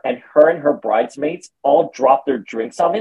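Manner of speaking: ordinary delivery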